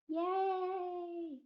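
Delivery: drawn-out, high "yay"; mock cheer. Vlogger announces own diagnosis to viewers, mock cheer.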